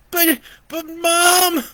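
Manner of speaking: put-on very high slow child wail; scared child screaming. A narrator's reading. Brandon screams for his mother under the covers, very high. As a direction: cry out for Mom. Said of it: "Brandon screamed back".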